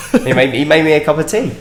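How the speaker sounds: ordinary talk, nothing marked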